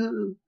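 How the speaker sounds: high, loud little "ooh"; mimed-effort "ooh". Acted. Speaker miming a tug on partner's hair, playful ooh. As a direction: give a high mock-straining ooh. Mimes gently tugging hair.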